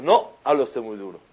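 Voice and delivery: no, insist firmly; insistent repeat. Retold or imitated. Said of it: "Y le está diciendo".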